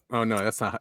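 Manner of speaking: ordinary delivery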